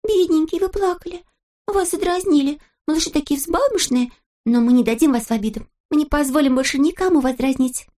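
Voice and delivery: high-pitched, cooing sympathy; pitying little girl. A narrator's reading. Girl consoles teased Neznaika, pitying little-girl voice. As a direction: fuss over the poor victim in a high voice. Lastochka pities the teased boy.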